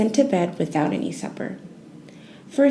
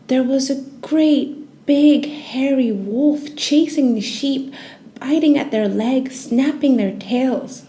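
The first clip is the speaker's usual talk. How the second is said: dramatic narration; 5 semitones higher, 3 dB louder, slower than usual. A narrator's reading. The wolf seen.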